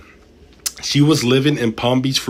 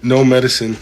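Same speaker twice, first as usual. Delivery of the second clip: ordinary delivery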